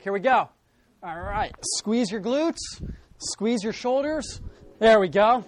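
loud, high workout countdown calls; aerobics instructor. Real, not acted. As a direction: pump up the class, counting down.

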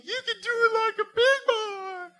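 high, laughing patronizing coax; coaxing parent. Retold or imitated. Parent coaxing a crying kid, high patronizing coax. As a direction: sweet-talk a whimpering child. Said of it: "there they are looking".

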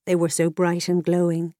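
usual speaking voice

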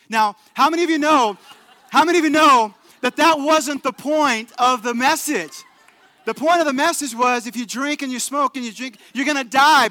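laughing, then loud and emphatic; loud preaching. Real, not acted. Preacher to the congregation, loud and laughing. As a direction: drive the point home with full voice. Preacher drives the point home.

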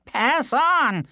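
very high-pitched villagers' cry; frightened villagers. A narrator's reading. Villagers send the runner away before hiding, high alarmed voice. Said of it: "they said".

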